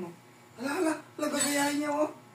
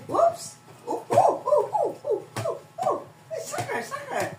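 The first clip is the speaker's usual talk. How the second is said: excited. Real, not acted. Cheering baby's ball play.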